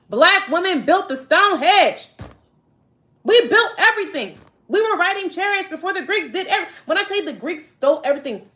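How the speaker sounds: high, loud triumphant boast; proud excited boast. Real, not acted. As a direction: crow it with pride.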